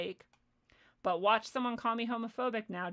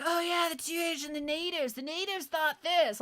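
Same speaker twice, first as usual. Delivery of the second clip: high-pitched mocking New Age believer; mock idiot voice; 6 semitones higher, 3 dB louder than usual. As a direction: imitate a clueless believer, pitched up. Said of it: "You sound like a fucking idiot".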